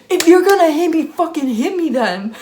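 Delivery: high, loud mocking imitation; acted confrontational challenge. Small woman stepping up to her, mock confrontational.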